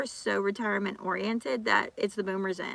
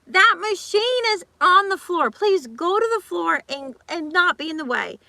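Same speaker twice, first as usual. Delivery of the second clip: louder, higher firm scolding; retold telling-off; 8 semitones higher, 7 dB louder than usual. [name] scolding a gym hog, loud and high. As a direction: order them off the machine.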